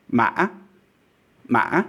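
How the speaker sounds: "Ma" caught by a glottal stop, then rising sharply; hiccup tone. Shown as a demonstration. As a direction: hiccup in the middle of "Ma" and jump up. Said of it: "you will have this tone".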